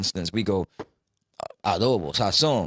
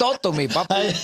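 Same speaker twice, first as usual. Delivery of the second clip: mock relative; 6 semitones higher than usual. Mimicking family teasing in Spanish.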